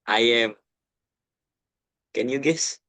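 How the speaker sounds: ordinary talk, nothing marked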